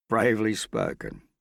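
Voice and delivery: mock her courage; contemptuous. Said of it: "he said contemptuously".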